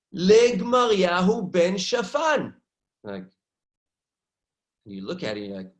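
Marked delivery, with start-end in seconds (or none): 0.1-2.5 excited reading. Retold or imitated. Reads the seal aloud.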